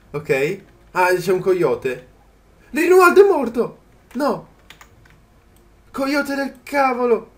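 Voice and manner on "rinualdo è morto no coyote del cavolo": high, loud cry that his pet died; dismayed shout. Real, not acted. Gamer shouting when his pet Rinualdo dies, dismayed high yell. Pet dinosaur killed in game.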